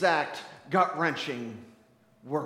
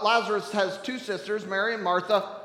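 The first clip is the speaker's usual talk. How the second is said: ordinary talk, nothing marked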